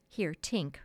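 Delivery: plain reading voice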